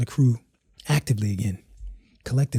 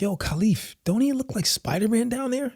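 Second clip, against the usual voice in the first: much higher, softer wife's voice; excited wife; 10 semitones higher, 5 dB quieter than usual. Retold or imitated. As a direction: point him out to her husband with delight. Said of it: "my wife is like".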